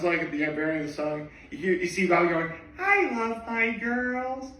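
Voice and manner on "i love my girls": high, drawn-out mock gushing; doting manager. Fond manager gushing over the singers, high drawn-out coo. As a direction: stretch each word in a high, softer coo.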